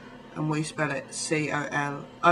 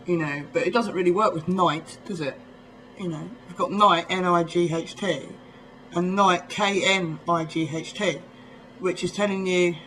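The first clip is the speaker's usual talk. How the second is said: usual speaking voice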